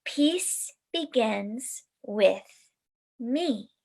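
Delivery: slow, even chant of each word; calm affirmation chant. Shown as a demonstration. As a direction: say it slowly and deliberately. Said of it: "practice the affirmation".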